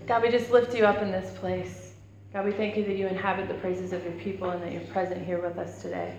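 soft, hushed congregational prayer. Real, not acted. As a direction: pray quietly and humbly over the room. Opening prayer before the sermon.

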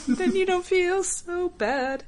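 ordinary talk

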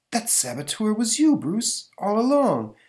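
high shocked exclamation; shocked realization. Acted. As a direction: gasp out the discovery.

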